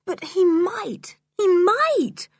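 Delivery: high, tearful-sounding plea; slow, high, upset worrying; fearful insistence. Acted. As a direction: draw it out in rising distress. George frets dog may be poisoned.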